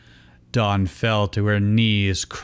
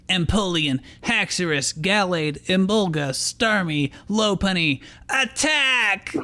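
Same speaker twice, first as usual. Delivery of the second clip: roared command; 9 semitones higher than usual. A narrator's reading. Ash commanding his Pokemon to attack. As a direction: call out each Pokemon then roar the order. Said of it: "he roared".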